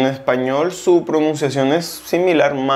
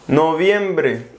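ordinary talk

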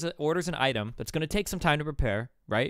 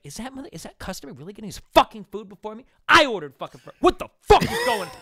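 high, loud, whiny rising outrage; shrill-free high huffy customer voice building to a yell; mock angry customer; 9 semitones higher, 9 dB louder than usual. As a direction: play the indignant customer, getting louder.

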